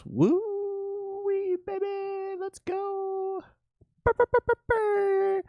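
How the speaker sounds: very high squeaky cheer; falsetto whoop. Acted. Cheering a chat friend's trade, falsetto whoop. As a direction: whoop like a cartoon.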